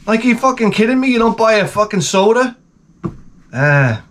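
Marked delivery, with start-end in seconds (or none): none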